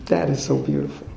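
softly, in quiet wonder; hushed awe. Real, not acted. Repeated in awe, softer.

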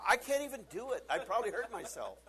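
high, loud mock child's complaint; struggling little girl. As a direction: wail like a kid tangled up. Voices daughter tangled in her shirt.

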